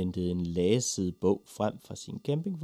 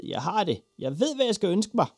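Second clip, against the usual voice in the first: high, loud cry of triumph; boy bursting with his big idea; excited yell; 10 semitones higher, 5 dB louder than usual. A narrator's reading. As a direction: shout it out breathlessly eager. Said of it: "skreg han".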